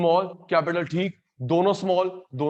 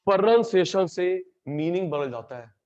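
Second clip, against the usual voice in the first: ordinary talk, nothing marked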